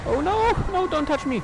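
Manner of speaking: high panicked pleading; being chased. Real, not acted. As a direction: scream at it not to touch. Chased by ghost.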